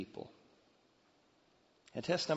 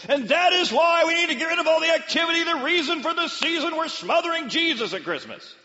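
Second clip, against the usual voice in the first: much higher, louder fiery preacher; animated pastor; 11 dB louder than usual. Retold or imitated. Pastor telling the Christmas crowd, animated and loud. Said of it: "pastor gets real animated and says".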